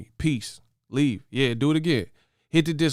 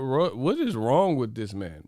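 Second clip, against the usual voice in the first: ordinary talk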